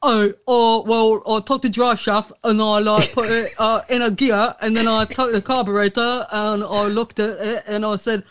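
chav mechanic explaining the repair; put-on British chav accent. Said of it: "same accent, working on a car".

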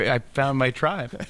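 ordinary delivery

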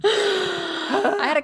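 high, slow words through laughter; laughing. Real, not acted. Answering her friend's teasing about a step stool, laughing. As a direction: laugh while you answer.

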